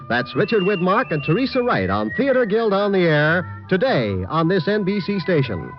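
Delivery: ordinary talk, nothing marked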